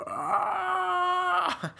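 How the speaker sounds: mock howl of grief, very high; imitated howl. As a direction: wail it like an overacted movie villain. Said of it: "starts, like, howling".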